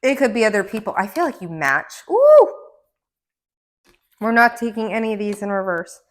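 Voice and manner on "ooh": loud, high delighted ooh; a thrilled squeal of an ooh; excited ooh. Real, not acted. Reader delighted by a tarot card.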